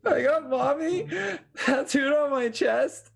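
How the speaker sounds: high, tearful wail; crying. Acted. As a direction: blubber it out, slowly. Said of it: "You're still crying profusely".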